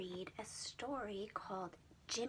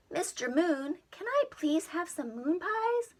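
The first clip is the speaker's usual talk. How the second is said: put-on boy asking politely; 8 semitones higher, 13 dB louder than usual. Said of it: "Jimmy asks the moon".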